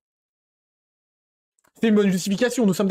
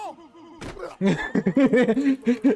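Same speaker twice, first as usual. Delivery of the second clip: hearty laugh; burst of laughter; 6 semitones higher than usual. Real, not acted. Laughs at the clip.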